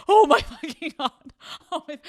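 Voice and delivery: high, loud laughing disbelief; disbelieving laugh. Retold or imitated. Girlfriend laughing in disbelief at her boyfriend, high and loud. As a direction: laugh at how hopeless he is.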